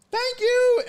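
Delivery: high hooted thanks; loud aunt. Retold or imitated. Aunt takes the food, loud high thanks. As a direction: imitate an aunt trilling thanks at full volume. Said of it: "she was just loud".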